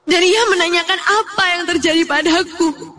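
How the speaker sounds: high-pitched, distressed narration; shaken. Acted. Neighbour runs over to ask what happened, narrator shaken. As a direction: tell it still frightened and upset.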